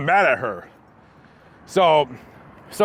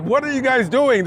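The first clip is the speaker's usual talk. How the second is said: high, alarmed question; 9 semitones higher than usual. Retold or imitated. Rider strapped to the stretcher, asking alarmed. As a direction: demand what's going on. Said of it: "And I'm like".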